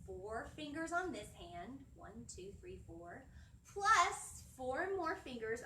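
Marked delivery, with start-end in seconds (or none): none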